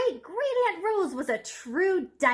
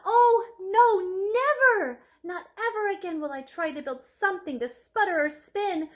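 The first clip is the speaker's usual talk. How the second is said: anguished outcry; 6 semitones higher, 5 dB louder than usual. Acted. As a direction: cry it out in despair. Rosie's dismayed thought.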